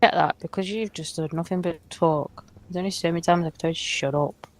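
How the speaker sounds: ordinary talk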